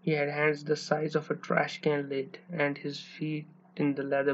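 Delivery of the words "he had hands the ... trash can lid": plain reading voice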